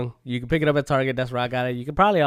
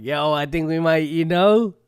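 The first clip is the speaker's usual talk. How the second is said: louder and higher, building up; excited kid; 3 semitones higher, 7 dB louder than usual. Retold or imitated. Quoting his kid self.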